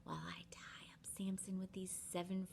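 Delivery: secretive whisper. Retold or imitated. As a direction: hush the men into hiding. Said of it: "then she's like".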